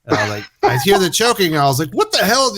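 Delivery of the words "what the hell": alarmed. Retold or imitated. Alarmed dad hears choking.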